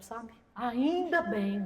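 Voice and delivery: ordinary talk, nothing marked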